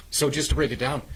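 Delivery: hoarse, frog in throat. Real, not acted. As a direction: talk through a scratchy throat. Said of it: "I got a frog in my throat".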